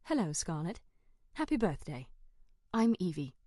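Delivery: a hint of a polite British assistant; British accent. A narrator's reading. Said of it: "greets me with a British accent".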